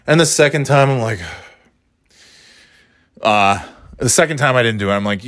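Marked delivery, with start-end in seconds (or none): none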